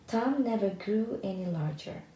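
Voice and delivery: usual speaking voice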